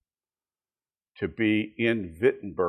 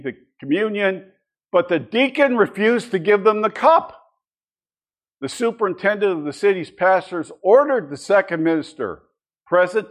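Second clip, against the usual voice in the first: higher, louder storytelling read of a church quarrel; dramatic reading; 7 semitones higher, 7 dB louder than usual. Acted. Teacher reading a historical account to the class, dramatic read-aloud. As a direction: read the old account out like a tale.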